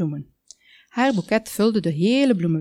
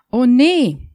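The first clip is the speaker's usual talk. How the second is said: alarmed cry; 5 semitones higher, 8 dB louder than usual. Acted. Ladder tipping toward the cake.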